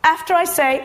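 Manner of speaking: usual speaking voice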